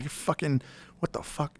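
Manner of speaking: high-pitched mock swearing, kept soft; retold cussing out. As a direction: imitate a sputtering superior cussing at someone. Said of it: "cusses me the fuck out".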